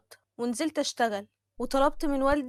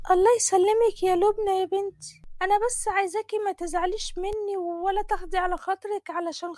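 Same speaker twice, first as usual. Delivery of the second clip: higher-pitched aunt, apologising, near tears at the end; pleading aunt; 6 semitones higher than usual. Acted. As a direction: plead with her niece in a raised old-woman voice. Narrator voices the aunt. (طنط بتستسمح، بصوت أعلى وعلى وشك البكا)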